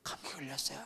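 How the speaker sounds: whispered, lost-voice croak; imitated hoarse voice. Student excusing his lost voice to teacher, imitated hoarse whisper. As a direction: force it out with no voice left. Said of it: "너희 목소리 왜 이래?".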